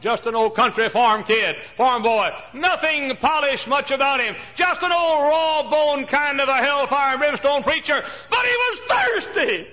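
high, loud, slow pulpit cry; preacher shouting about the farm-boy pastor; full-force preaching. Acted. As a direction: preach it out to the crowd.

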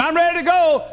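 high, very loud acted call; shouted announcement. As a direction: holler it as if coming downstairs. Acts out Mel coming downstairs.

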